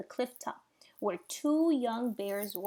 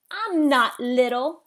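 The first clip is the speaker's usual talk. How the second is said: snapped; 3 semitones higher, 11 dB louder, slower than usual. A narrator's reading. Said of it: "snapped Thorn under his breath".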